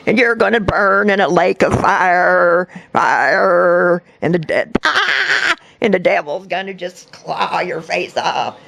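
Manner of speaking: slow mock doom voice with a hammed-up cry; imitating the hospital lady's hellfire warnings, slow and mocking; mocking a hellfire zealot. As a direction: ham up a crazy lady raving about demons. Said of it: "And she kept".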